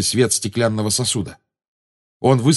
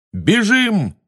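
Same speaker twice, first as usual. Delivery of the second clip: high, urgent call to flee; contained high-pitched shout; shouted order; 10 semitones higher than usual. A narrator's reading. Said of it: "крикнул Гарри".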